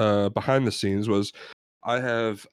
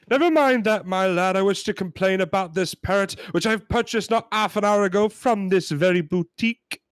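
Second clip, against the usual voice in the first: high, indignant British customer; hammed-up British complainer; British accent; 7 semitones higher than usual. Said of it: "We're gonna try our British accents".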